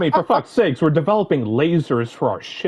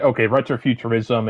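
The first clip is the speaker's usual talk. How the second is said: ordinary talk, nothing marked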